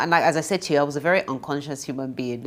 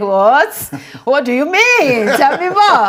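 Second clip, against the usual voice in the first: very loud, high-pitched, incredulous outburst; shocked demand; 11 semitones higher, 13 dB louder than usual. Retold or imitated. Telling the interviewer how she reacted to the confession, shocked. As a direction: shriek it in hammed-up astonishment.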